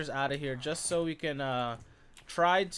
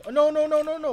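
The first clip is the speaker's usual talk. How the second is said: high loud frantic no no no; loud high panicked pleading; panicked shouting; 12 semitones higher, 11 dB louder than usual. Real, not acted. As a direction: yell in panic as it walks out. Villager walking out.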